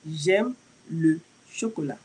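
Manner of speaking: slow, careful French "J'aime le chocolat"; slow model pronunciation of "J'aime le chocolat"; teacher says "J'aime le chocolat" slowly for repeating; French model sentence. Shown as a demonstration. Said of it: "So you would say".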